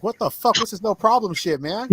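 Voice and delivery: high, loud retold outrage; outraged retort. Cheated client confronting his lawyer, outraged retort. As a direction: act out an indignant protest, pitch jumping up.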